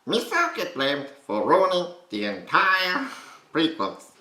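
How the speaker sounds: high, slow, loud goofy voice with an American accent; Jar Jar Binks. Shown as a demonstration. Coach showing the finished impression, high American-accented Jar Jar. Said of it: "kind of an American accent".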